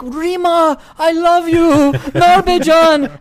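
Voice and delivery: high, loud, cracking cry of a begging father; emotional father's plea. Retold or imitated. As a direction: wail it like a soaked father begging to come home. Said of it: "And they're just yelling".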